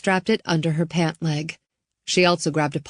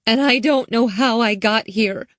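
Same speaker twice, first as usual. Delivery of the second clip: put-on higher girl's voice fighting tears; holding back tears; 5 semitones higher, 4 dB louder than usual. Lost girl telling Riley, mildly tearful. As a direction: swallow the tears and push the words out. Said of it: "as if trying to stem the tide of tears".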